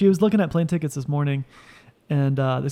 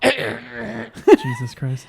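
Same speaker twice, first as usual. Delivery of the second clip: laughing; 8 semitones higher, much slower than usual. Real, not acted. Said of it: "Were you choking on your mint?".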